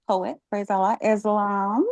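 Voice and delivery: normal talking voice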